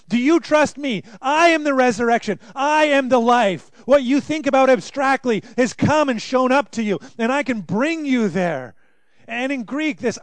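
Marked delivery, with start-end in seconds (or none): 0.1-8.7 louder, higher pleading voice; Jesus voiced urgently. Preacher voicing Jesus, loud and urgent. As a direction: press it on her like a challenge. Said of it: "He says".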